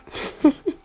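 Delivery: sudden loud squeal of a laugh; high burst of laughter. Real, not acted. Laughing at her own made-up word, loud high laugh. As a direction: break into a high giggle.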